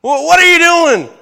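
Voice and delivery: loud, high startled challenge; acted alarmed yelling; emphatic shout. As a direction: yell it as if surprised by an intruder. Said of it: "I can picture emphasis here".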